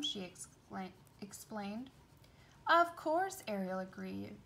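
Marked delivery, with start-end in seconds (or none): 2.7-3.4 high, loud happy agreement; loud, higher "of course"; eager yes. Acted. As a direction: agree gladly.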